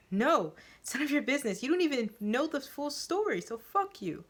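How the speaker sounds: angry yelling at the counselor; furious outburst. Acted. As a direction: lash out before storming off. Girl storms out in fury.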